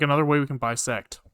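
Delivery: ordinary talk, nothing marked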